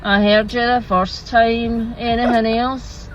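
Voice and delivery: slow, weary Scottish drive-through worker; unimpressed. Acted. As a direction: drag it out, fed up with the customer.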